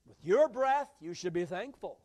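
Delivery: wisecracking friend. Acted. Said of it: "His friend said".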